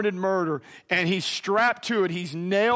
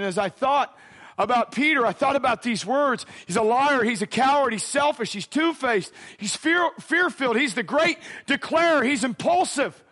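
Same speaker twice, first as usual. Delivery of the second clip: high, punchy list of charges; impassioned preaching; 6 semitones higher than usual. Real, not acted. Preacher lists Peter's failings to the congregation, high and forceful. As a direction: hammer each label, pitched up.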